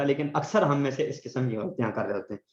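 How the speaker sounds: ordinary delivery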